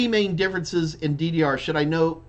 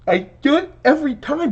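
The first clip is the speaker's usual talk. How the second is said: words pulled out through a yawn, higher and louder; 11 semitones higher, 6 dB louder than usual. Real, not acted. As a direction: talk right through a big yawn. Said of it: "Why do I get these yawns?".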